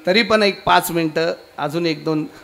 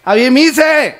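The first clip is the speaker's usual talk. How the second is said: loud, high burst of exasperation; high-pitched indignant cry; exasperated shout; 7 semitones higher, 9 dB louder than usual. Acted. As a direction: blurt it out loud, fed up with being asked. Pandit exasperated by repeated question.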